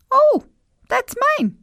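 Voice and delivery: put-on very high little girl voice; high girlish protest; Gina's voice. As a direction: squeak it out like a small girl.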